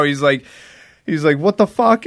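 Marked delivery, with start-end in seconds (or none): 1.4-2.0 high startled what the fuck; mock shock, pitched up; retold stunned outburst.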